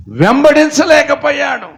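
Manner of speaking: వెంబడించలేకపోయాడు shouted high and loud; preacher's shout. Real, not acted. (వెంబడించలేకపోయాడు అని గట్టిగా అరుపు)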